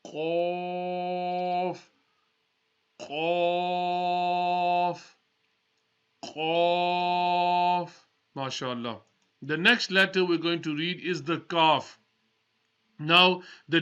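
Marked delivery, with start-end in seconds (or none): 0.1-7.9 Arabic Qaf from the throat; thick Qaf. Shown as a demonstration. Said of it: "top part of the throat".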